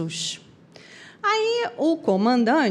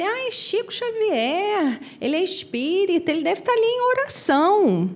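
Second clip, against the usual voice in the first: lightly voiced admirers; 7 semitones higher than usual. Passengers remarking on the famous medium beside them, higher imitated voice.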